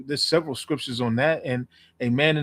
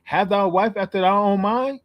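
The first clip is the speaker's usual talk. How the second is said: slow, louder, higher preaching read; solemn scripture; 7 semitones higher, 5 dB louder, slower than usual. Shown as a demonstration. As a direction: drag out forsake her not for weight. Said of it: "It's saying".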